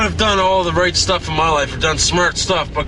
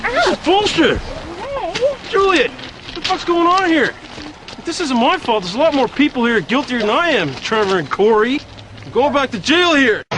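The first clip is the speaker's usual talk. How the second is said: furious shouting; 12 semitones higher than usual. Acted. Groom yelling at Julian as police ruin his wedding, furious. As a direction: rage as the wedding falls apart.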